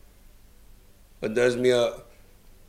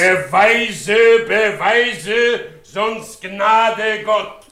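higher, louder, slow menace on Gnade; slow threatening demand; 6 semitones higher, 5 dB louder, much slower than usual. Acted. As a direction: warn him slowly, rising in rage. Threatening over his wife's honour.

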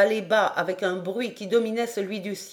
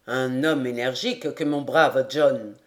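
low-pitched character voice; lord's voice; 7 semitones lower than usual. A narrator's reading. Lord praises his captain to companions, in low voice. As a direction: speak much lower, as the lord. Lord's remark entering the wardroom.

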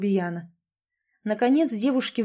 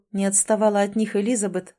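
plain reading voice

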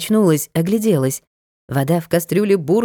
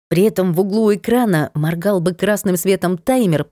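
ordinary delivery, nothing marked

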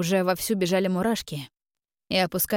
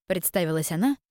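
ordinary delivery, nothing marked

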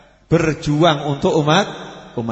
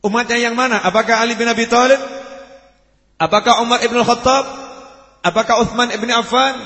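insistent rhetorical questions, fired in series; forceful preaching; 5 semitones higher, 3 dB louder than usual. Acted. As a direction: preach it out with raised, driving voice.

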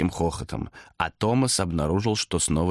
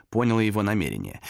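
plain reading voice

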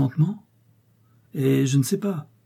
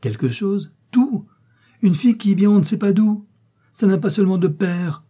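high, loud indignant outburst; storming; 9 semitones higher, 5 dB louder than usual. A narrator's reading. Mother storming against the penniless bride, indignant. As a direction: let the storm break, pitched right up. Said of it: "il lui faudrait supporter un orage".

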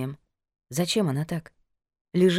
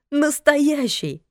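drawn-out tearful shout, high; angry crying; 9 semitones higher, 5 dB louder, much slower than usual. A narrator's reading. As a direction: wail the word through tears. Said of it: "сквозь слёзы прокричала Элиза".

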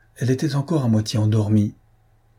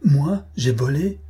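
slow, higher-pitched astonished question; frantic incredulity; 5 semitones higher, slower than usual. A narrator's reading. Umilta answering the theft accusation, incredulous. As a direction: draw it out in disbelief, without laughing. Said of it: "partit d'un éclat de rire frénétique".

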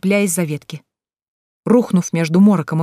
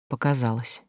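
quiet reassurance; 5 semitones lower, 9 dB quieter than usual. Acted. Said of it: "вслух произнесла".

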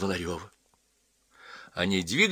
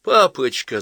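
higher, louder tearful cry, acted; 6 semitones higher, 7 dB louder, slower than usual. Daughter crying as she hugs her father, tearful. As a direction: plead with a touch of tears. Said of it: "заплакала Ася".

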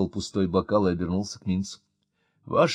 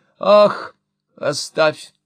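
a loud, higher "Ах" brushing it off; dismissive; 5 semitones higher, 8 dB louder, much slower than usual. A narrator's reading. Mother brushing off her son, dismissive. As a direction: wave the son's worry away. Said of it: "ответила мама".

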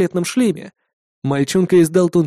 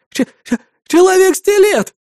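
high, loud stammering shriek; terrified scream; 5 dB louder than usual. A narrator's reading. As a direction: shriek it in panic, stuttering. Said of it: "завопил мальчонка". (истеричный визг, заикаясь)

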